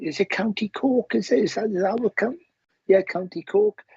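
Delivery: high-pitched, lightly imitated southern Irish accent. Medium checks the grandmother's county, southern Irish accent. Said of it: "a southern accent".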